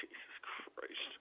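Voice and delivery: slow breathless whisper of despair; exasperated whisper. Real, not acted. Streamer giving up on his chat, whispered. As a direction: mutter it under your breath.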